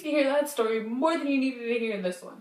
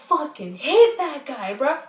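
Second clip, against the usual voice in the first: angry outburst; 3 semitones higher, 9 dB louder, slower than usual. Retold or imitated. Retold hatred of ex.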